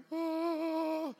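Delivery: high, loud, held "whoa"; hammed-up panicked wail; frightened cry. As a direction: yelp in mock terror, stretched out. Startled by president's shout.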